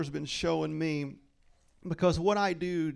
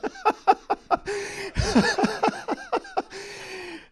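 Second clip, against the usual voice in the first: long real laugh, much higher; high burst of laughter; 6 dB louder than usual. Real, not acted. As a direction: break into loud laughter. Laughing at own reaction.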